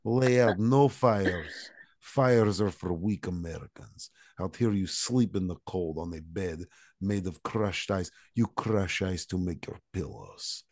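a light, put-on Russian accent that boasts of the hard life; fake Russian accent. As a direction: brag about the cold in a mock-tough Russian accent. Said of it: "Russian accent is fake".

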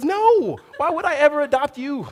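high, loud mock-exasperated mom; mom's teasing reply. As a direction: toss it off as a joking mother. Mom's joking reply in story.

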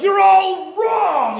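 high, slow, stretched-out yell at the church; angry shout. Retold or imitated. Man bursting into church yells at the congregation, high slow yell. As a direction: bellow it like a madman bursting into church. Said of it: "some guy came in the back and he's like".

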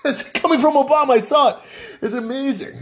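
high, laughing delivery; amused amazement. Real, not acted. Host telling listeners what he saw, laughing in amazement. Amused at retold Obama greeting.

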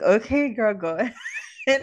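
loud, laughing through it; laughing okay girl. Retold or imitated. Mom laughing as daughter copies her outfit, loud and amused. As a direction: cheer her on, cracking up.

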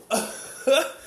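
helpless rising laugh; real burst of laughter. Real, not acted. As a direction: crack up for real. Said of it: "I could not stop laughing".

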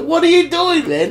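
high, laughing mock disbelief; amazed mate. Mate watching a huge skate jump, high laughing disbelief. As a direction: squeal it out like you can't believe the trick. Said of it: "It was just like".

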